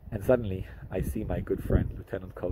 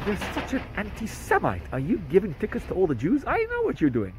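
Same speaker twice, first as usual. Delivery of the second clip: accusing; 9 semitones higher than usual. Retold or imitated. Neighbor accuses cop in story.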